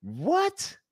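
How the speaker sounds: high-pitched, rising disbelief; incredulous. Real, not acted. Fan defending a twelve-hundred-yard quarterback, incredulous. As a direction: let the question shoot upward, baffled. Incredulous rant about dropping Fields.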